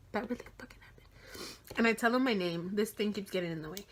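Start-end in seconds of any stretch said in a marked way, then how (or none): 0.1-1.5 breathless whisper of disbelief; stunned whisper. Retold or imitated. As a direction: whisper it like you can't believe it. Disbelief while hugging her idol.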